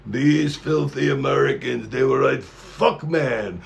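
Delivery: lightly put-on Japanese accent. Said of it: "That was my Japanese accent".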